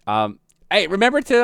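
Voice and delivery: normal talking voice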